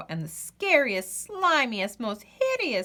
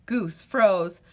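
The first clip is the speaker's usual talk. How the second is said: ordinary delivery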